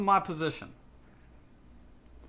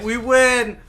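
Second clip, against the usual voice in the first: higher and louder victory call; excited win; 6 semitones higher, 8 dB louder than usual. Real, not acted. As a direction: cheer the win. Celebrating a win in game.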